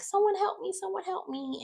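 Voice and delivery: high-pitched imitation of someone trapped; mock cry for help. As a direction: squeal for help, hammed up, not real panic. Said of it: "banging on the door".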